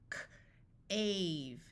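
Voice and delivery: crisp K, pause, then "ave"; sounded out. Shown as a demonstration. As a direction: break "K, ave" into separate sounds. Phonics teacher sounds out, then blends.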